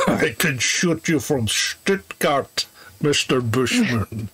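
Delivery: slow, laughing mock villain; a slow gloating Putin send-up through laughter; mock Putin threat. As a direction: drawl it out like a smug strongman.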